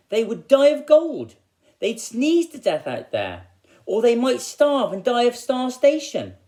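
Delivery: high, loud, over-the-top fretting; hammed-up panic. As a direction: play the woodcutter frantic about the children. Woodcutter panics about children dying.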